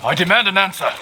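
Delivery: high, loud and forceful; angry demand. Acted. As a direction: demand it, furious. Confronts teacher angrily.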